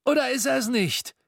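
put-on very high, croaky old man's voice; a thin old Scrooge croaking in disbelief. A narrator's reading. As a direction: squint and croak the question. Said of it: "krächzte er".

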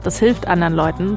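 ordinary talk

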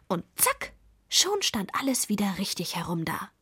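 plain reading voice

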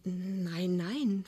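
hushed, stammered denial; timid, intimidated murmur; fearful whisper. A narrator's reading. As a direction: falter softly in fear. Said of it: "flüstert Pinneberg angstvoll".

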